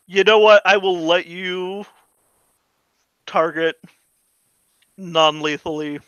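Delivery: ordinary delivery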